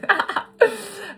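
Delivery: a sudden, high, loud laugh; high burst of laughter. Real, not acted. Laughs at her own joke.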